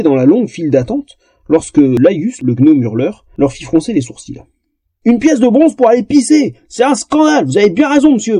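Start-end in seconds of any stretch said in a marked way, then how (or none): 5.0-8.4 bawling. Acted. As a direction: rant like an outraged dwarf. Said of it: "brailla l'un des nains".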